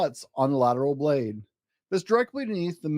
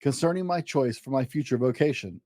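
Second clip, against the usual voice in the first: usual speaking voice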